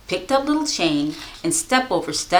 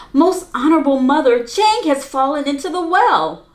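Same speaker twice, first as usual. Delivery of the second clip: urgent report; 6 semitones higher, 3 dB louder than usual. Acted. Boy runs to mother.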